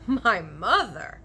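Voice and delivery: slow, loud astonished giant cry; cried out. A narrator's reading. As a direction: bellow it in disbelief. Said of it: "cried the B F G".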